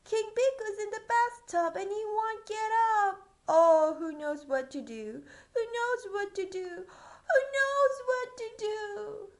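high loud worried cry; panicked page. Acted. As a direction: wail it like a frantic page. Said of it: "cried the court".

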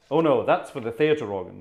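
ordinary talk

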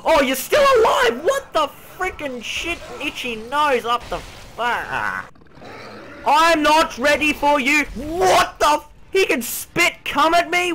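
high, loud alarmed shouting; panicked yelling. Real, not acted. Player shouting at a monster that won't die. Enemy still alive.

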